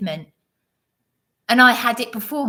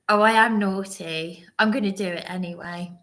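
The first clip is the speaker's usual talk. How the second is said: normal talking voice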